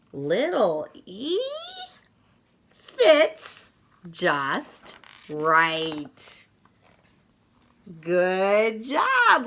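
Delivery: lively picture-book read-aloud with punchy sound words; reading to children. Acted. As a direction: read it like story time for little kids, full of bounce.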